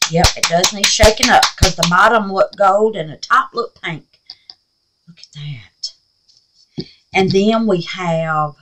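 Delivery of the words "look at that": slow, soft unvoiced whisper; awed whisper. Real, not acted. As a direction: breathe it out, amazed. Whispering in awe at paints.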